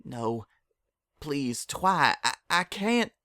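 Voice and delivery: pleading that jumps high in panic; beg, voice cracking upward; panicked plea to an accusing friend; frightened pleading. Acted. Applejack begs accusing Twilight.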